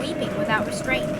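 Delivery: ordinary delivery, nothing marked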